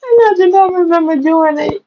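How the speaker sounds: high voice just out of laughter; laughing denial. Real, not acted. Denial right after laughing.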